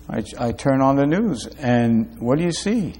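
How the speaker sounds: hushed late-night musing; quiet aside. Acted. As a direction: drop right down, as if telling it privately. Preacher recalls a quiet evening.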